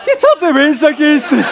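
high, loud put-on character yell; shouted catchphrase. Performing a requested catchphrase for fans, high shout.

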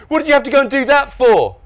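high, loud protest; exasperated complaint. A narrator's reading. Norm berating helpful brothers, exasperated. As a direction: demand it in utter exasperation.

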